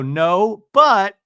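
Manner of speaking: loud, high punch on BUT; imitated combative comeback cut short; ready to go. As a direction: hit "but" hard, ready for a fight. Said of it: "normal Tamra ready to go".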